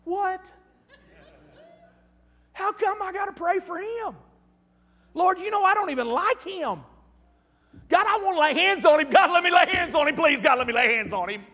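high, pleading complaint to God; indignant plea to God. Retold or imitated. A man at the altar protesting to God about his enemy, pleading high. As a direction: whine up at God to be allowed to hit him.

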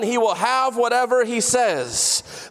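ordinary talk

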